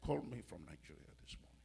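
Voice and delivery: very soft, low and quick; quiet, low aside; hushed repeat. Real, not acted. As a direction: drop right down, almost to yourself. Repeats, dropping to quiet storytelling.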